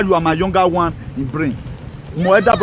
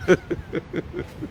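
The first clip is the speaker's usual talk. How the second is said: high mocking laugh; 10 semitones higher, 10 dB quieter than usual. Real, not acted. Laughs at destroyed guy house.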